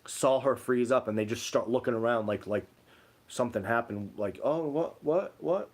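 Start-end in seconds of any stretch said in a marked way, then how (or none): 4.4-5.7 startled, rising "what, what?"; confused what. Retold or imitated. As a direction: mimic bystanders caught off guard. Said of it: "They were talking like this".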